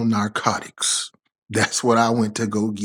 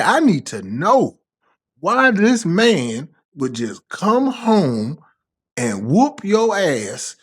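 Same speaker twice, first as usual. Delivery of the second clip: slow, drawn-out questioning; 6 semitones higher, slower than usual. Retold or imitated. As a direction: stretch every word out, disbelieving. Retold confronting his girlfriend.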